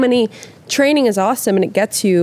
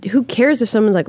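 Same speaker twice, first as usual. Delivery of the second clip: usual speaking voice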